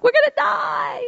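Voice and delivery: high-pitched mock wail of alarm; mock panic. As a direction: imitate panicked disciples, voice shooting way up. Disciples panicking in storm, acted.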